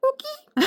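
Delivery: very high chirpy frog call; coqui frog call. Shown as a demonstration. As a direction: chirp it like a tiny tree frog. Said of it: "Okay, higher pitch".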